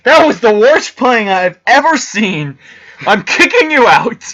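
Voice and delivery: high, loud, slow outraged rant; mock outraged Varkath. Player voicing Varkath kicking him out, hammed-up fury. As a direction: ham up a furious poker boss throwing him out. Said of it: "He's like".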